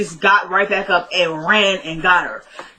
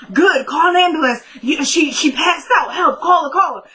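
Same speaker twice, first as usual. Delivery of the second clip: mock frantic urgency; 7 semitones higher than usual. Retelling Travis faking panic.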